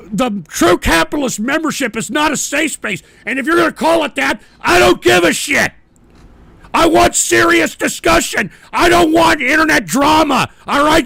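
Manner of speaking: loud, high-pitched furious rant; real anger, building to a yell; angry shouting. Real, not acted. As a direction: shout down the trolls. Host berates the trolls.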